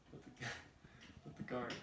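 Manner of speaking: ordinary talk, nothing marked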